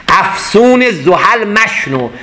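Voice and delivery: very slow, lingering verse; slow poem recitation. Acted. Teacher chanting Rumi to listeners, very slow. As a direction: let the verse ring out slowly.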